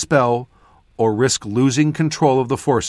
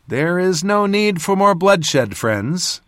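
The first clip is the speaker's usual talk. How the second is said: put-on young northerner; 6 semitones higher, slower than usual. A narrator's reading. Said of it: "It was the voice of a northerner".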